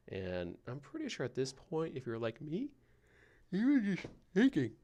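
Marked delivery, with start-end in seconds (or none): 3.5-4.7 words stretched by a real yawn; yawning mid-word. Real, not acted. Caught by a yawn while telling the listener about yawns. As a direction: talk right through a yawn, pitch leaping up. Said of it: "excuse me".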